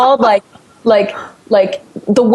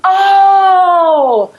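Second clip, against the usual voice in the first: long, high 'oh' of mock understanding; retold sudden realisation; 10 semitones higher than usual. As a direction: draw it out as the penny drops. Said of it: "And I was like".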